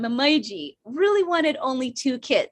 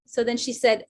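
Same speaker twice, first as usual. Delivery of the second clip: ordinary delivery, nothing marked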